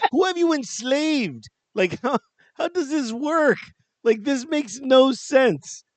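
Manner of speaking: high, slow, laughing incredulity; mock disbelief. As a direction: act stunned at the bargain. Retold disbelief at cheap deal.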